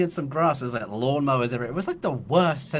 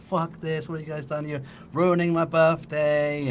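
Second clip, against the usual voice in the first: higher-pitched mock whine of outrage; upset friend; 5 semitones higher than usual. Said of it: "really upset about it".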